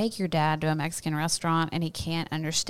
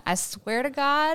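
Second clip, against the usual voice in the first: high, slow mock exasperation; imagined exasperated listener; 8 semitones higher than usual. Voicing an imagined listener fed up with accents, mock exasperated. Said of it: "I can imagine ants in my plants right now going".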